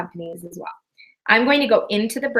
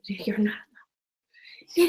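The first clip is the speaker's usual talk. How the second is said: slow, soft and sincere; mean it, slow it right down; 4 dB quieter, much slower than usual. Shown as a demonstration. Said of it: "that sounded like a telemarketer".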